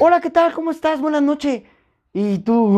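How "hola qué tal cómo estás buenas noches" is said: much higher and bright, a friendly booth attendant's greeting; imitating the upbeat toll-booth guy greeting drivers, pitched high; cheery attendant. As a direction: greet like the happiest worker on the night shift. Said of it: "te atiende chulada, manito".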